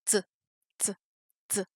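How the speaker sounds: short "T" said as ts, with no vowel; three brief, separate ts sounds; isolated ts consonant. Shown as a demonstration. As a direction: release T as a crisp consonant alone. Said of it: "Ça se prononce comme ça".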